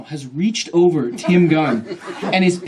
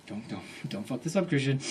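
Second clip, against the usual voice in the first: muttered self-warning; 2 semitones lower, 12 dB quieter than usual. Retold or imitated. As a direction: mutter a nervous warning to yourself. Urging himself during the interview.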